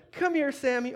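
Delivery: high-pitched call to a pet; coaxing a dog. Retold or imitated. Owner calling his dog one last time, high coaxing voice.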